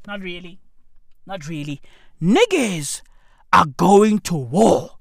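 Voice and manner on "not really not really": much softer, lower not really; trailing off; fading dismissal. Real, not acted. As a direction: let it die away.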